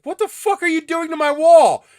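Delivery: dad yelling about his wall, high and loud; furious yell. Retold or imitated. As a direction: scream it like an outraged dad.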